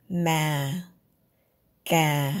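low Ma, ga. Shown as a demonstration. Teacher gives low-tone examples. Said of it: "Start low and stay low".

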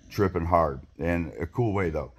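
ordinary delivery

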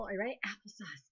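ordinary delivery

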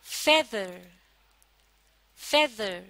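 Feather with a firm फे and lighter दर; teacher's model feather, first half stressed; stress on first syllable. Shown as a demonstration. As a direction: lean on the फे of feather. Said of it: "पहले हिस्से को जोर देकर".

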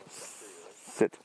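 low, hushed sit command; soft command. Real, not acted. Trainer hushing a dog into a sit, low and soft. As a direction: murmur the cue softly to the dog.

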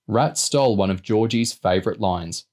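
ordinary delivery

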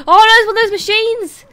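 loud, high cry of alarm; high-pitched "oh no" yell at a game threat; alarmed outcry. Real, not acted. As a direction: call out in sudden alarm. Game enemy suddenly appears.

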